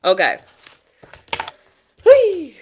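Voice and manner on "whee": high, loud squeal of glee; gleeful whee. Real, not acted. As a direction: let out a happy whoop. Gleeful shout opening the reading.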